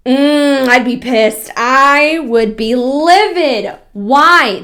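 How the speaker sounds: loud, slow livid outburst; indignant outrage. Real, not acted. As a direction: let the anger spill out slowly. Angry reaction to sales pitch.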